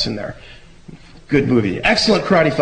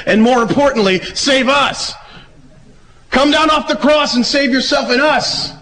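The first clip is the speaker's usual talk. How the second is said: mocking demand; 10 semitones higher than usual. Criminal on the cross demanding rescue, high mocking voice. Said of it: "then one of the criminals started to mock Jesus".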